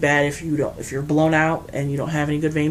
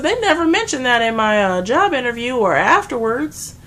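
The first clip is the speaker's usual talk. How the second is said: higher and louder indignant complaint; indignant protest; 7 semitones higher, 7 dB louder than usual. Retold or imitated. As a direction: protest like you were tricked. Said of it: "I said".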